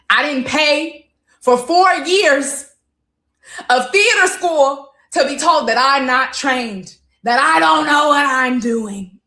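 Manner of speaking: loud, high, slow indignation; indignant. Real, not acted. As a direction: draw out each word in loud outrage. Indignant at being called untrained.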